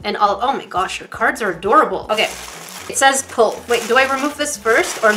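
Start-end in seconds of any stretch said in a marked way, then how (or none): none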